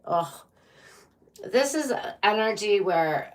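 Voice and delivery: ordinary delivery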